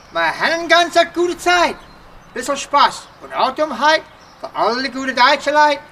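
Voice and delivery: hammed-up Dutchman greeting the crowd; Pennsylvania Dutch comic persona. Comic doctor belting his Pennsylvania German welcome.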